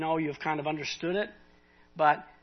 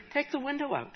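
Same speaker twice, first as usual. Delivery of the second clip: higher, gentle divine nudge; voice of God; 9 semitones higher, 3 dB quieter than usual. Retold or imitated. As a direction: let God's voice float up high and simple. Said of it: "I just felt like God saying to me".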